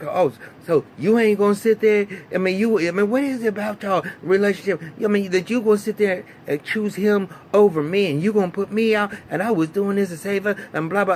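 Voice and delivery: high, loud put-on whiny rant; mock upset woman. Holly's quarrel acted out.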